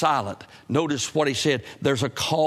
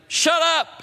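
sharp, much higher and louder snap; brash shut up; 5 dB louder than usual. Shown as a demonstration. Preacher showing what God did not say, brash loud snap. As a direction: bark it out rudely. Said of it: "it's not like a brash statement".

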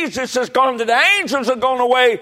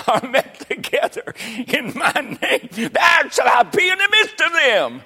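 building preaching shout; 4 semitones higher than usual. Real, not acted. Preacher quoting Jesus' promise, climbing to a shout. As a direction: build from steady to ringing on "midst of them".

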